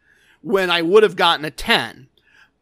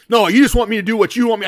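fast, louder mock-angry retort; imitating anger; 3 semitones higher, 5 dB louder, much faster than usual. Angry gambler arguing with a fellow player, retold anger. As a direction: cut in fast, acting out his annoyance. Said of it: "now he's really mad".

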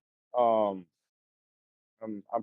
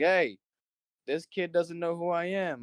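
higher-pitched mock yell to the manager; retold yell; 9 semitones higher than usual. Tracy Morgan calling to the manager, mock yell. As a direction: call out across the shop, pitched high, half-playful. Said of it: "yelled to my manager".

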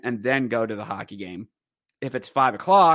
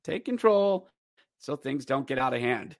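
normal talking voice

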